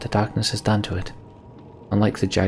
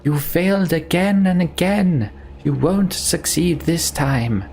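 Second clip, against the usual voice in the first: high, slow shouted defiance; high-pitched battle challenge; defiant shout; 10 semitones higher, slower than usual. A narrator's reading. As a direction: shout back at the monster, drawing it out. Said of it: "Juno shouts back".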